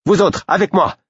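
high, loud call to arms; rally the men to follow; shouted battle order; commanding shout. A narrator's reading. Félicité commanding her warriors.

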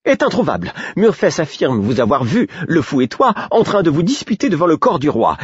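aiguë et pressée, en vrac; précipitée. A narrator's reading. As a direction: tout déballer d'un coup. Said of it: "Dans sa hâte de tout me raconter". (Patience pressée de tout raconter)

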